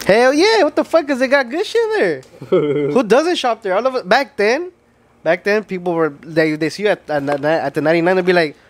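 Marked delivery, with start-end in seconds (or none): 0.1-2.2 excited. Real, not acted. Cohost defending the ninety-nine cent store, excited. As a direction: jump in fired up in defense.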